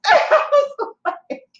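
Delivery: high laugh breaking through the words; squealing laugh at her own bad luck. Real, not acted. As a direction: laugh in disbelief.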